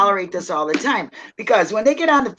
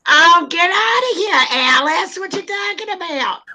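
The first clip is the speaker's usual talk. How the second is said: high-pitched gossipy Bronx voice; hammed-up Bronx accent; New York Bronx accent; 7 semitones higher than usual. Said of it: "don't you like my New York Bronx accent".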